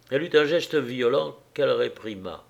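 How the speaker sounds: plain reading voice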